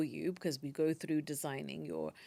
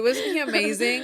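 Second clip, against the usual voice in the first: excited; 4 semitones higher, 10 dB louder than usual. Real, not acted. Gushing about a mentor.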